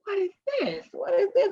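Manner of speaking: high, alarmed repeated question; waking up and finding tubes in her chest; confused alarm. Retold or imitated. Waking in the hospital to her mother, high alarmed question. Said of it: "And I'm like".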